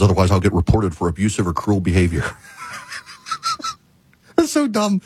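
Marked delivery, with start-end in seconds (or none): 4.4-5.0 said through laughter, high; real amused laughing words. Real, not acted. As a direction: laugh while saying it. Laughing about the joke.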